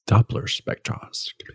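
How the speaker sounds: breathy whispered repeat; whispered echo. Real, not acted. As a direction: echo the term under your breath. Host echoes the term softly.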